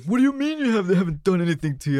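high, fast, outraged disbelief; mother on the phone, shocked and angry, in a mild mock outrage; imitating angry mom. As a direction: play the furious mom, voice jumping up. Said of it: "She's pissed".

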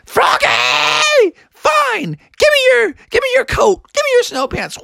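very high falsetto mother; scolding mom. Acted. Exasperated mom demands clothes.

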